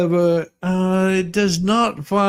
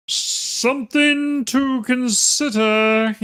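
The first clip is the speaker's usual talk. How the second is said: mocking read; 8 semitones higher, much slower than usual. Mocking the email's phrase.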